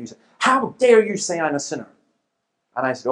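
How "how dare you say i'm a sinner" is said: high, loud indignant outburst; imitated anger. Offended man confronting the debater, mock indignant fury. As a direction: act out an offended heckler's outrage. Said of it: "He's really, really angry".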